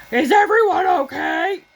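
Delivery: put-on a kid doing the neighbor again, with gusto; daughter's impression of the neighbor. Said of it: "[name], how does she really say it?".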